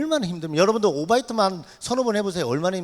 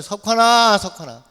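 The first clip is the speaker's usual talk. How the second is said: imitated calling out; 6 dB louder, slower than usual. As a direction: imitate someone calling from outside, then fade. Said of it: "누가 저를 또 불러요".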